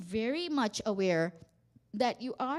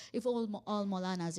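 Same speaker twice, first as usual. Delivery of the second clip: usual speaking voice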